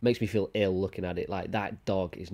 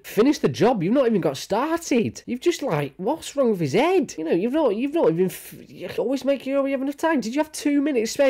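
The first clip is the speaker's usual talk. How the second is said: much higher, worked-up rant; mock outrage at the groomer; exasperated rant; 14 semitones higher, 4 dB louder than usual. Real, not acted. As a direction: let the disbelief climb. Ranting at groomer.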